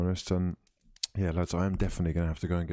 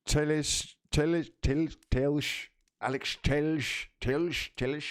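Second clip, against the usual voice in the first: higher-pitched, unsure tries at a Portuguese accent; attempted Portuguese accent; 7 semitones higher than usual. Shown as a demonstration. Match host unsure how to say a player's name, attempted Portuguese accent. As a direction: grope for the Portuguese way of saying Teles. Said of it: "wanna say it in a Portuguese voice".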